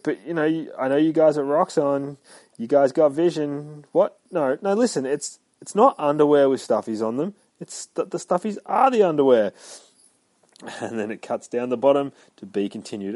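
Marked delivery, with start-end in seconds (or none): 0.0-9.5 pleading a little, higher and louder; insistent sales pitch. Acted. Deadpool pitching Roxxon by phone, mildly insistent. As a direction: push the idea at a doubting listener.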